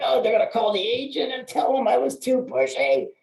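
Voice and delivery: high, whiny mock fretting; hammed-up high-voiced dread of being told on; mock worry. Loan officer imagining clients complaining to the agent, mock-worried.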